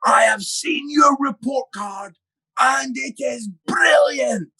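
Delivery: fake-angry dad shouting good news; mock stern shout. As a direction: shout the praise as if it were a scolding. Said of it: "but he's shouting".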